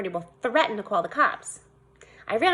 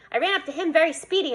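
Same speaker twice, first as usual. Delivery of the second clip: ordinary delivery, nothing marked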